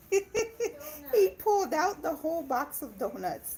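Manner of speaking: laughing while telling on her son; amused giggling through the words. Real, not acted. Mom laughing about her son raiding the donuts.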